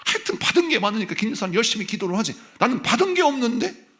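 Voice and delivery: mock a believer who envies Deacon Kim; jealous whining. Pastor mocking a jealous believer to the congregation, whiny and high. Imitating a jealous church member.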